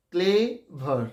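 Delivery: Clever broken into "cle", a gap, "ver"; slow syllable-by-syllable. Shown as a demonstration. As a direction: sound out both syllables of clever slowly. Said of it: "আস্তে আস্তে যদি উচ্চারণ করি".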